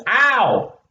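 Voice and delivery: sudden cry of pain. Real, not acted. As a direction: yelp as you get hurt. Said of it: "We have an injury on set".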